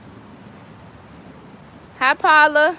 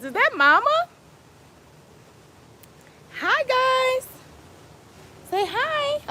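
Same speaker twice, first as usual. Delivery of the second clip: pet talk; 13 semitones higher, 4 dB louder, slower than usual. Real, not acted. Owner to her dog on live, high baby-talk voice. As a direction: coax the puppy to say hi, squeaky and sweet.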